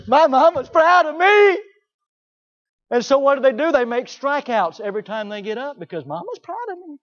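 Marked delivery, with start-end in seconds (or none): 0.1-1.6 put-on high, loud, slow sing-song kid gloat; child bragging in a high chant; smug kid. Kids parroting their praise. 6.0-7.0 put-on high sing-song kid voice; smug kid. Kids parroting their praise.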